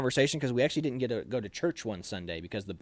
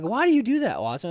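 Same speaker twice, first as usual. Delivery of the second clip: high, louder baffled question; puzzled buddies; 10 semitones higher, 5 dB louder than usual. Retold or imitated. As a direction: ask it like a confused platoon mate. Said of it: "people would be like".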